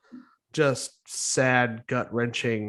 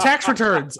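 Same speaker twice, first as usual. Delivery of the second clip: higher, louder sudden jolt; mock scare shout; 7 semitones higher, 5 dB louder than usual. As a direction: blurt it to startle someone. Jump scare to cure hiccups.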